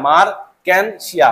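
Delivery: মার্কেনশিয়া said slowly, each syllable clear; slow careful pronunciation. Shown as a demonstration. Teacher modelling a name for students, slow and careful. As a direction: spell out মার্কেনশিয়া slowly for notes.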